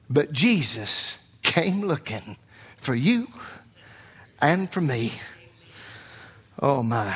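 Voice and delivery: low, slow, measured preaching; hushed reverence. Real, not acted. Preacher telling the church Jesus came looking for them, low reverent tone. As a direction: drop low and let each phrase rest. Preacher drops to tender reflection.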